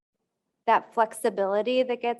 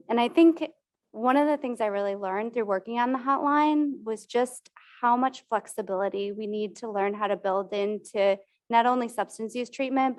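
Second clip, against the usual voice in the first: usual speaking voice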